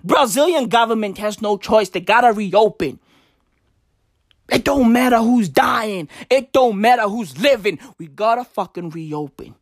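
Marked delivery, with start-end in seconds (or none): none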